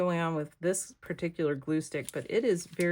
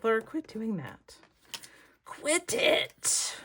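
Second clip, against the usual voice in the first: a high, scolding viewer calling out by name; mock viewer scolding; 4 semitones higher, slower than usual. Viewer scolding the crafter, high mock scold. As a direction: play a fed-up viewer telling her to stop. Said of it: "Y'all are like".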